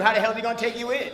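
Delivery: ordinary delivery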